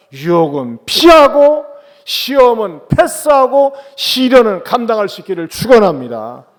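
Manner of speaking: high, loud preaching blessing; fervent proclamation. Real, not acted. Preacher blesses the congregation to overcome trials, loud fervent proclamation. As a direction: punch out each phrase at full force.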